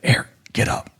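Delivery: urgent night whisper; frightened wife's urgent whisper. Retold or imitated. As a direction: hiss it so nothing outside hears. Wife waking him at night.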